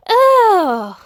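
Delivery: loud high disgusted ugh; sudden squeal of disgust; disgusted cry. A narrator's reading. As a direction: yelp in disgust at the cream. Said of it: "cried Mister Brown".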